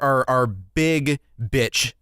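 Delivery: ordinary talk, nothing marked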